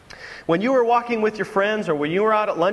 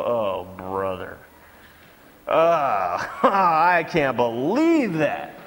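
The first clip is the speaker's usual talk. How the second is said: scornful groan; 8 semitones lower, much slower than usual. Retold or imitated. Mocking the clumsy girl.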